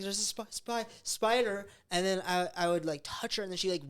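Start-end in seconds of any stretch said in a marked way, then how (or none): 0.0-1.7 mock terrified. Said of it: "screaming, like".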